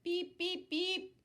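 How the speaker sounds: high, loud beeping horn; truck horn beeps. Acted. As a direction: beep like a little truck horn. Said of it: "said Little Blue".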